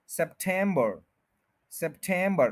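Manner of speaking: September as sep-TEM-bur, three syllables; middle-syllable stress. Shown as a demonstration. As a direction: put full weight on "TEM" in September. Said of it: "टेम के ऊपर पूरा stress". (सितंबर में "टेम" पर पूरा ज़ोर)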